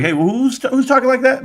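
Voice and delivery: startled dad. Retold or imitated. Dad hearing his kids talk.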